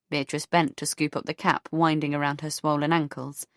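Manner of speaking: plain reading voice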